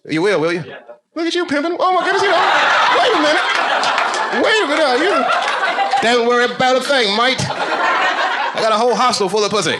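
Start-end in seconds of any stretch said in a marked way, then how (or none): 1.1-9.8 high, loud hustler voice, laughing; mock pimp hype. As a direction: play a loud smooth pimp selling the hostel.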